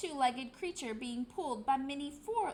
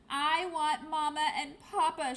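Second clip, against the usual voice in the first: louder wailing chick cry; 3 semitones higher, 7 dB louder than usual. Acted. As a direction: wail for her parents, lost. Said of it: "she wailed".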